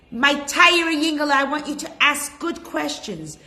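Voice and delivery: mother sending her son off to school, gushing. Acted. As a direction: fuss over him like a mother at the school bus. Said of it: "she says".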